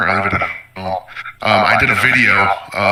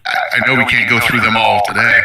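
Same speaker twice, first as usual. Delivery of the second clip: ordinary talk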